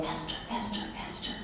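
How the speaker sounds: very soft, half-voiced muttering; seething. Wife stewing after hanging up on husband, seething mutter. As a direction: stew under your breath, imitating a sulk.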